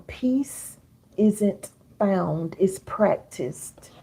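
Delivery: very slow, every word spaced out; slow, deliberate quotable line; slow dictation. Shown as a demonstration. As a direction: dictate it slowly so they can write "Peace isn't found, it's practiced". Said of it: "Write this down".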